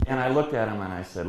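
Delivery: ordinary delivery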